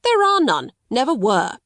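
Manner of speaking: sharp loud outburst, voice leaping high. Acted. Solicitor bursts out to the headmistress, high and loud. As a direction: burst out in flat denial. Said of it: "he broke out".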